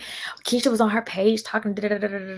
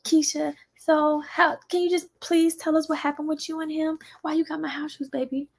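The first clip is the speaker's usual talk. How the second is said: high, syrupy fake-nice voice; sing-song like a nosy fake friend; mocking fake sweetness; 9 semitones higher than usual. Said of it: "They come and they say".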